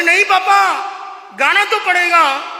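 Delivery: put-on high, loud insisting boy; stubborn child. Son insisting to his father at night, loud. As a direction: refuse like a headstrong kid.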